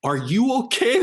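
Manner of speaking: very high imitated lady's voice; retold worried question in a high voice; concerned fan. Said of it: "And I'm crying".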